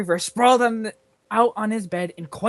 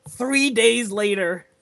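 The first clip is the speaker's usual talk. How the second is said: plain reading voice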